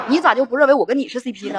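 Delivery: laughing tease. Real, not acted. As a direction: tease her while laughing. Teasing joke, laughing. (笑着调侃)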